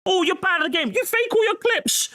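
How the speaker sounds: high, mock whiny heckler voice. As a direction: sneer it like a troll in chat. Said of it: "the peanut gallery throwing peanuts".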